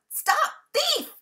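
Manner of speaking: high, loud angry shout after a thief; shouted call. A narrator's reading. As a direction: yell it across the garden, chasing. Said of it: "calling out".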